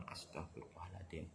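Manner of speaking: whispered plea for forgiveness. Real, not acted. Preacher ending the prayer, whispered. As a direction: breathe the words with no voice.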